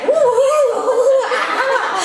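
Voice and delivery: put-on a child shrieking with delight; excited squeal. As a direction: squeal with glee.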